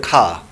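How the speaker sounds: کھا with open vowel, no R at the end; British dropped R. Shown as a demonstration. Teacher showing how the British say it, R dropped. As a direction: leave the R off کھا, British style. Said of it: "British نہیں بولتا آر کو".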